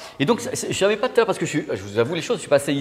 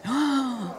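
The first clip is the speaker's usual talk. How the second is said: amazed gasp; 10 semitones higher, 5 dB quieter than usual. Real, not acted. Amazed on entering.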